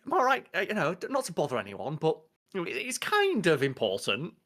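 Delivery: high, hesitant polite caller; put-on phone voice. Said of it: "you've gotta do that thing where it's like".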